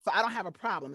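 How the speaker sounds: normal talking voice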